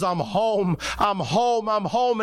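rising pitch with each repetition, acted joy; retold rejoicing. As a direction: imitate a man overjoyed to be home. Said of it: "And he's rejoicing".

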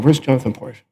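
low, quiet inner-thought voice; musing to himself. Retold or imitated. Guest puzzling over a familiar name, musing quietly. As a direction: think it under your breath, puzzled.

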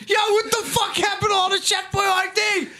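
high-pitched imitation of a yelling driver; mock indignant holler. Driver discovers the Chef Boyardee is missing, mock holler. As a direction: holler it like a baffled delivery man.

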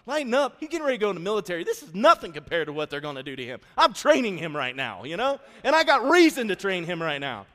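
high, loud, rapid defensive outburst; heated retort. Retold or imitated. Husband defending training son to wife, loud heated retort. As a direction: argue back hard at your wife.

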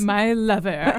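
slow, sultry mock seduction; seductive. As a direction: croon it like a lover.

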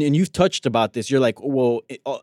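normal talking voice

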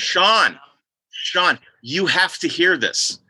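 imitating an excited, insistent interruption; urgent calling out. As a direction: call his name sharply, then slow down insistently. Excited woman interrupts Sean's conversation.

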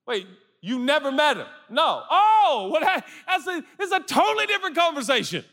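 high, quickening letdown; the truth dawns; surprised realization. Acted. Acted realization.

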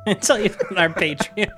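ordinary talk

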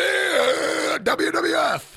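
high, loud hype voice; wrestling announcer. Pretend. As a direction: call it like ring announcer.

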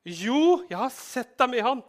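high, loud yes-I-saw-you; loud insistence. Acted. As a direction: refuse to back down. Accuser insists again. (høyt, stædig rop)

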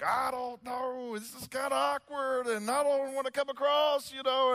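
mock awkward dithering voice; waffling. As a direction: play someone squirming out of sharing faith. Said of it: "I just start waffling back and forth".

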